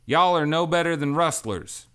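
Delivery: loud, high indignant cry; angry accusation. A narrator's reading. Lark angrily calls the crew rustlers, loud. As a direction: throw the insult at them.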